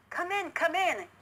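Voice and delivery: high, bright welcome calling a guest in; exclaimed welcome. A narrator's reading. Country Chipmunk greets his city friend, excited. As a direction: throw open the door with a happy cry. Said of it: "exclaimed the Country Chipmunk".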